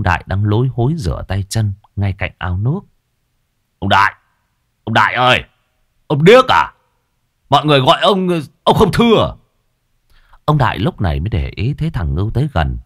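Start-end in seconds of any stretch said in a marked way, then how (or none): 3.8-9.3 shouting rudely across the pond; loud jeering call. Acted. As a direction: raise the voice to reach him, getting higher and ruder. Ngưu calling out to Đại.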